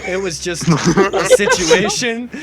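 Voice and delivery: ordinary delivery, nothing marked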